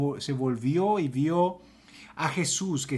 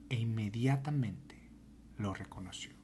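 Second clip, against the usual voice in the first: hushed whisper, dropping away; 6 semitones lower, 12 dB quieter than usual. Acted. Preacher hushes as Mary recognizes Jesus, whispering. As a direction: breathe the moment of recognition.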